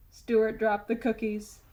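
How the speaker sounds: ordinary delivery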